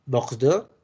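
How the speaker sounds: Bordeaux with a French uvular r, rising; French pronunciation. Shown as a demonstration. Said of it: "Burdeos es Bordeaux".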